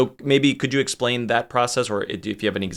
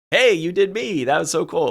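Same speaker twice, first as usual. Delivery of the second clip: high, excited imagined compliment; acted-out happy surprise; delighted greeting; 6 semitones higher than usual. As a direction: gush it like a thrilled fan. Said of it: "they come back and say".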